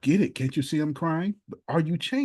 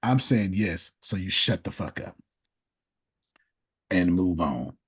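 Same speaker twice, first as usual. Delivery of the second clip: mock inner voice; 8 semitones lower than usual. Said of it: "Ceremonial yes says".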